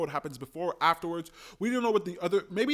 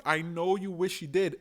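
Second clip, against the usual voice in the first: ordinary talk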